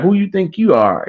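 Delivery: high-pitched retold backtalk; nasty challenge. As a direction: imitate a rude demand to know who he is. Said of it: "like, nasty with him".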